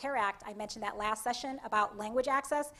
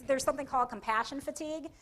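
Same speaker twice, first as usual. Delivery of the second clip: ordinary talk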